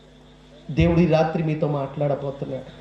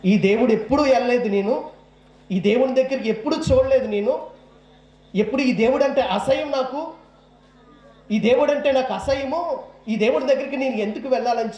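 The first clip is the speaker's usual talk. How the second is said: pitched up, indignant outburst; heated rant; 7 semitones higher than usual. Retold or imitated. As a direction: spit out his old contempt for this god. Retelling his angry rant against God.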